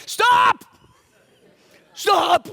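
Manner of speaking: sudden high-pitched yell; explosive, very high holler; shouted interruption. Retold or imitated. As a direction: cut him off with a loud shout. Acts out cutting him off.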